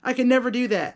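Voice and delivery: usual speaking voice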